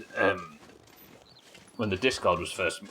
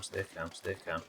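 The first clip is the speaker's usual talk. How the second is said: much softer and lower, calming herself; lower, softer self-soothing murmur; fading to a murmur; 4 semitones lower, 12 dB quieter than usual. Real, not acted. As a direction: sink the voice to a near-hush. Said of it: "Be calm, stay calm".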